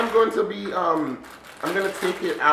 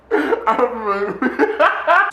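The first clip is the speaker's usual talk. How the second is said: loud, high burst of laughter; high giggle; 7 semitones higher, 4 dB louder than usual. Real, not acted. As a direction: break into a high-pitched giggle. Giggles at own suggestion.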